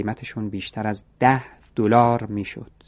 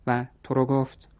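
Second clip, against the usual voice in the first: usual speaking voice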